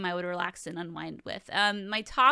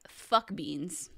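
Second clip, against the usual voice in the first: ordinary talk, nothing marked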